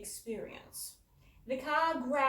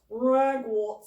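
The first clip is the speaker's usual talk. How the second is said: gloomy; 3 semitones higher, 13 dB louder than usual. A narrator's reading. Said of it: "said Dad gloomily".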